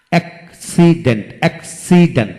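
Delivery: Accident in three beats, ak, si, dent; split into syllables. Shown as a demonstration. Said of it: "তিনটি syllable থাকে".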